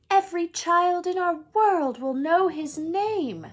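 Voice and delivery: high, loud scolding disbelief; McGonagall, indignant. A narrator's reading. McGonagall warning Dumbledore about the boy's fame, high and loud. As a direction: scold him with rising alarm.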